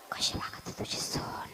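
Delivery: slow, hushed whisper; gossipy whisper. Acted. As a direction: whisper a nosy question in confidence. Acts out discreet gossip question.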